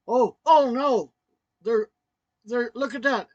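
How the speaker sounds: loud, high retold cry of alarm; imitating wife's alarm. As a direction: act out her sudden fright, loudly. Wife's alarm at what she sees.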